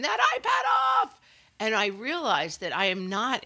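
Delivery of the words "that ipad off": high, sharp-pitched shout; acted yelling. Mom yelling at kids about the iPad, high shouting. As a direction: holler it across the house, way up high. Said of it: "Me yelling at them".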